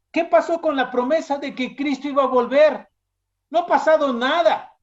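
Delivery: high, scoffing challenge; mocking. Scoffers question a believer about the promised return, mockingly. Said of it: "Y que en son de burla".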